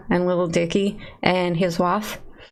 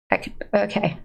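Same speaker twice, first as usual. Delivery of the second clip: ordinary delivery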